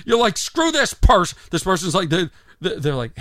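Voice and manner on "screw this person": higher-pitched indignant outburst; mock anger. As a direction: snap in acted irritation at someone absent. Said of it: "you're actually angry at that person".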